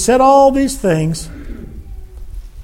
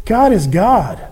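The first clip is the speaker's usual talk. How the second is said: ordinary talk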